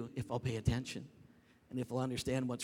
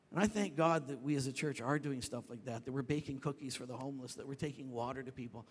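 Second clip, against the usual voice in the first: ordinary delivery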